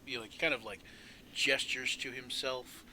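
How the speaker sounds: usual speaking voice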